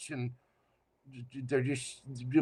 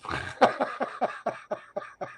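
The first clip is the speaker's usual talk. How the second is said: high airy laugh; soft wheezy chuckle; breathy giggle; 7 semitones higher, 5 dB quieter than usual. Real, not acted. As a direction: giggle at your own joke. Laughing after nap joke.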